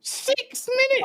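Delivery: high, slow mocking "Six minutes?"; mocking squeal. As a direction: stretch it out in a high sneer. Repeating the mock of added time.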